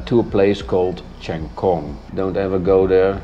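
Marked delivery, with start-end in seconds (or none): none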